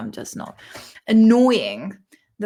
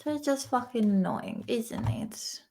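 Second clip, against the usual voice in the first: whispering; 8 dB quieter than usual. Real, not acted. Muttered aside under breath.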